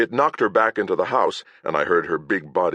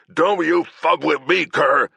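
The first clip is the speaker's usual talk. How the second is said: higher, louder angry shout; furious knight; 11 semitones higher, 5 dB louder, slower than usual. Acted. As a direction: threaten him through rage. Agravain furious at Kern.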